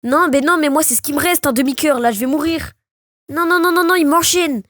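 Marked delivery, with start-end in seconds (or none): 3.3-4.6 high run of panicked no's; cornered gamer's higher-pitched alarm; panicked protest. Real, not acted. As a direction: plead against the hits coming in. Being combo-hit in the fight.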